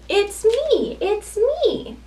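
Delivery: high, sing-song reveal; storybook sheep. Acted. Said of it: "says the sheep".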